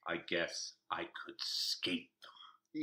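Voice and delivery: slow breathy near-whisper mock-drama; mock dramatic quote. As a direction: hams up the line as a hushed confession. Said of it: "and I quote".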